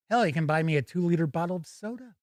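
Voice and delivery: ordinary talk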